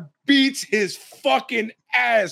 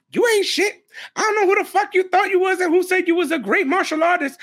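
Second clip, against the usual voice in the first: higher, mock trash-talking fighter; lightly voiced taunt, pitched up; 8 semitones higher than usual. Fighter taunting a rival's girlfriend, mock trash talk. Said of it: "distracting her like".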